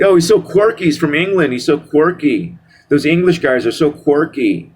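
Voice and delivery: high-pitched swooning fan parody; mock gushing. Mocks fans gushing over English guys.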